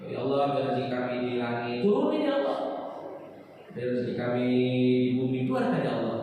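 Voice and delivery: demonstrating a polite, gentle supplication; gentle prayer. Shown as a demonstration. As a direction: ask humbly in a smooth, low chant. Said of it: "Cuman doanya pakai halus".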